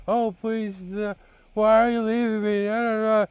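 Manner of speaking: high-pitched imitation of tearful pleading; mock crying. Dad imitating his girlfriend running after him, mock crying. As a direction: mimic a girlfriend begging in a whiny high voice. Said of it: "like, crying, going".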